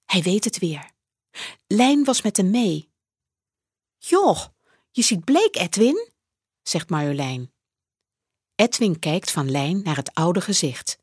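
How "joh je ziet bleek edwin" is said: put-on high, light girl's voice; young girl. A narrator's reading. Lijn tells Edwin he looks pale, high girl's voice. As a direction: read it as a worried young girl.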